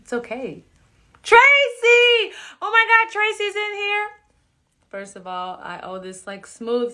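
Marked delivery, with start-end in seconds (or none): 1.2-4.1 high, loud delighted call; light up when she arrives; excited greeting. Real, not acted. Host thrilled a friend joined the live.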